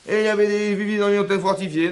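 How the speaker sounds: imiter les Meraglim, plus haut et plus fort; les espions alarmistes. Retold or imitated. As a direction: raconter les géants pour faire peur. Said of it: "ils nous disent". (les espions décrivent le pays au peuple, voix alarmiste et forcée)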